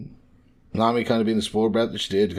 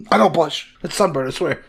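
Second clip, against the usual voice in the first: higher, louder mock denial; flustered denial, imitated; 7 semitones higher, 4 dB louder than usual. Blusher insists it is only sunburn, mock defensive. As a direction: play someone loudly denying a blush. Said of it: "after he blushes".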